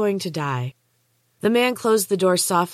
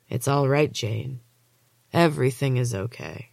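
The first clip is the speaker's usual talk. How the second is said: lower, gentle orderly; 4 semitones lower than usual. A narrator's reading. Orderly kneeling beside Jane, lightly lowered gentle voice. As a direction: comfort her with false kindness.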